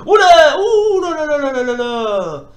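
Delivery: half laughing, half wailing in disbelief; laughing cry. Real, not acted. Laughing-crying as the opponent pummels him.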